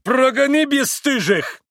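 high, loud summons; outraged shout, stretched at the end; roaring call. A narrator's reading. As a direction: bellow for the landlord. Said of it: "взревел вдруг пан Врублевский".

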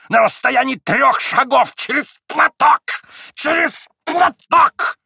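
high, loud, slow furious ranting; furious old man. A narrator's reading. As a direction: rage at your son, stamping. Old man raging, stamping feet.

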